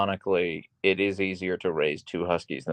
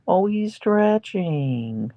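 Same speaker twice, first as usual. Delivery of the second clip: high, slow sing-song coo; 10 semitones higher, slower than usual. Real, not acted. Owner cooing at her husky, high baby talk.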